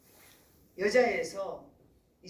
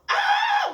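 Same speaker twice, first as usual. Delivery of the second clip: imitated baby shriek; 11 dB louder than usual. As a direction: belt out a baby's piercing yell. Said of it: "막 이렇게 소리를 지르는 거야".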